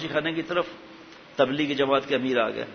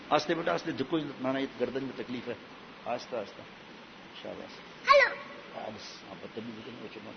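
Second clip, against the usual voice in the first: real off-mic murmur, trailing softer; soft aside; 3 semitones lower, 10 dB quieter than usual. Real, not acted. Aside to child about painful neck.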